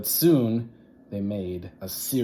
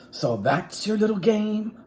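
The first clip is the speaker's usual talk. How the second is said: sly witch; 12 semitones higher, 4 dB louder than usual. Acted. Swamp catches the mask.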